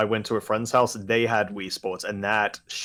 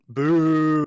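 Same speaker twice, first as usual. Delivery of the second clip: loud, higher drawn-out boo; mock jeer; 5 semitones higher, 6 dB louder than usual. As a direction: jeer at a friend in jest. Mock jeering a friend's way of playing.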